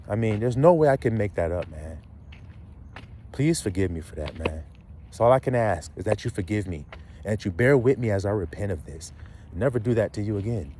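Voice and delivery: low, hushed plea for forgiveness; imagined repentance. Acted. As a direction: act out a humble, subdued apology.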